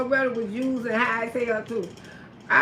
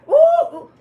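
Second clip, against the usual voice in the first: ordinary talk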